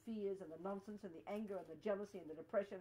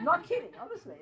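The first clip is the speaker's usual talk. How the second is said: higher, louder, through a real laugh; 7 semitones higher, 12 dB louder than usual. Real, not acted. Teacher assuring students she's serious about ridding all ego, laughing. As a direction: laugh while insisting it's true. Laughs at own outlandish-sounding claim.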